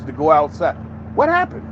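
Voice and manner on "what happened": high, disbelieving "what happened"; sharp high question of disbelief; incredulous question. Real, not acted. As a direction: ask it like you can't believe it. Asking in disbelief about London.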